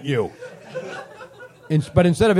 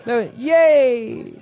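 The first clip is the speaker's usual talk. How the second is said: mock cheer; 5 semitones higher than usual. Shown as a demonstration. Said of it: "the most unenthusiastic yay ever".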